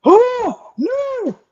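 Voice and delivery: hammed-up cowering from the needle; mock whimper. Patient pretending to shrink away from the nurse, whining high. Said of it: "You're just like".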